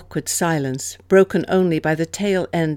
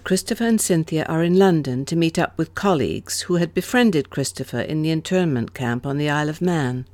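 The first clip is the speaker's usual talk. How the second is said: ordinary delivery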